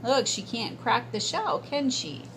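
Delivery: ordinary delivery, nothing marked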